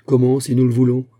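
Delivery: loud, emphatic exclaimed question; exclamation. A narrator's reading. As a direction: cry it out in surprise. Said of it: "S'écria Aramis". (en s'écriant, d'une voix forte)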